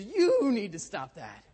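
loud, high, slow mocking echo; hammed-up parrot throwing the order back; parrot mimicking exasperation. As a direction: stretch YOU long and high, mimicking a scolding. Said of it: "the parrot stood up a little straighter".

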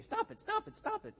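very high squawky parrot mimicry; frantic falsetto parrot echo. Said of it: "the parrot started jumping around the cage saying".

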